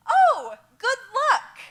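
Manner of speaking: amused carefree "oh, good luck"; unbothered local woman. Retold or imitated. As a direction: toss it off like a spider is nothing. Voicing unfazed Fijian woman with spider.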